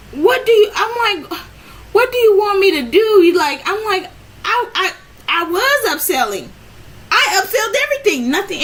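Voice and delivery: high, loud, frustrated plea; upset pleading. Retold or imitated. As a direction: make her see you tried. Retold heated plea to boss.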